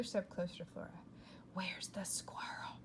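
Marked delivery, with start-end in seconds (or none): 1.5-2.8 breathy hushed whisper. A narrator's reading. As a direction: whisper it so nobody hears. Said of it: "she whispered".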